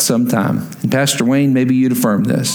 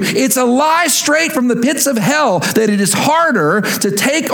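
higher, ringing pulpit voice that climbs on each point; preaching at full force; 8 semitones higher than usual. Acted. Pastor urging the congregation to lay down their burden, preaching at full force. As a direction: preach it out to the whole room, slowing on Jesus' words.

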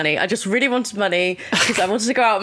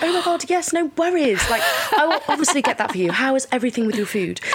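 posh waitress; 2 semitones higher than usual. Acted. Cafe worker charming posh customers, put-on posh voice. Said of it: "I would never speak like this at all".

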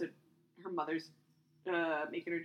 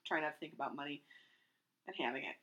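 normal talking voice

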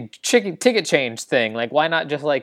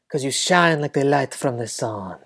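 creepy flirt. Acted. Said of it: "like the creep he is".

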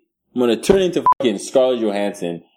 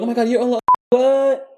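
starstruck fan; 11 semitones higher than usual. Retold or imitated. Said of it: "people are like".

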